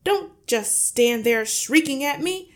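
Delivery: high, loud scolding; frantic, irate bluster; angry yell. A narrator's reading. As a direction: yell at your wife to stop gawping. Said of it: "the father yelled".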